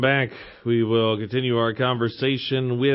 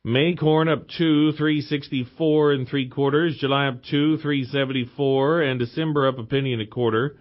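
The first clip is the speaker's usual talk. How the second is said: brisk market report read; radio markets announcer; reading stock prices. Acted.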